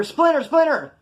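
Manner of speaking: high, loud call for attention. Acted. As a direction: holler a nickname to someone across the block. Inmate calls out from cell.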